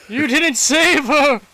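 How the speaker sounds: high, loud anguished cry; anguished outburst. Acted. Father first accusing Asante, in anguish. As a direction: cry it out at him.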